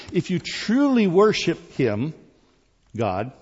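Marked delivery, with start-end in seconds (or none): 0.1-2.1 "truly" raised high in emphasis; stress on truly. Real, not acted. Preacher pressing congregation to worship, "truly" stressed high. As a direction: underline "truly" with a lifted voice. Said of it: "underline truly again".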